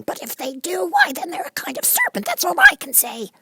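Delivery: very high bird voice, complaining; a fussy bird listing its troubles; high fretful pigeon voice. A narrator's reading. Pigeon concludes.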